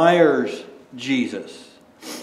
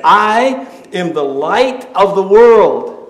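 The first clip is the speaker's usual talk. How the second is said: loud, slow proclamation; a solemn loud declaration; proclaiming; 3 semitones higher, 8 dB louder, slower than usual. Acted. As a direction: announce it boldly and slowly. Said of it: "And Jesus said".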